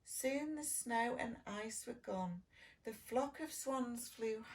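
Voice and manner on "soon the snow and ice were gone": ordinary delivery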